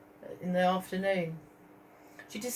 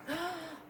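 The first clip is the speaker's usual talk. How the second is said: soft gasp; 8 semitones higher, 8 dB quieter than usual. Acted. Reader gasps at the painting.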